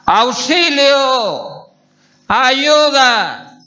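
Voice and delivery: high, long calls for rescue; desperate cry for help. Shown as a demonstration. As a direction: call out in desperation, stretching each word. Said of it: "Cuando estás desesperado".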